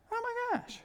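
very high, awestruck little gasp of words; high-pitched retold amazement; astonished realization. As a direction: act out it suddenly dawning on you. Acts out his sudden realization.

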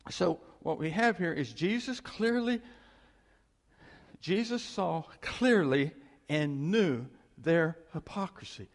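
usual speaking voice

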